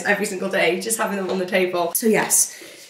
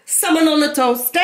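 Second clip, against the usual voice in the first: 1 mock French; 6 semitones higher than usual. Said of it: "We're practicing our French".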